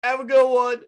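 high, loud mock-cheerful send-off; singsong shouted farewell; smartass yell. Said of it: "I just yell out".

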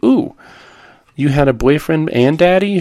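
put-on high, sing-song little-girl "Ooh"; lightly voiced child, teasing her mom. Daughter teasing mother about old boyfriend, high sing-song.

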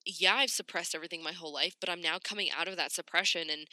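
ordinary delivery

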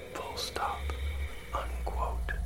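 punctuation breathed in a whisper; whispered dictation. Acted. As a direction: whisper the punctuation marks aside. Reading punctuation aloud.

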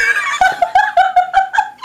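high shrieking laugh. Real, not acted. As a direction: burst out laughing hard. Big laugh at the neck story.

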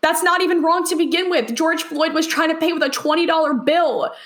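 normal talking voice

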